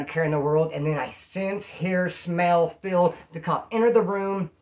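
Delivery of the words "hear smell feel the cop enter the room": ordinary delivery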